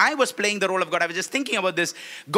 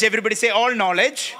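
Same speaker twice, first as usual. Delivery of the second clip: normal talking voice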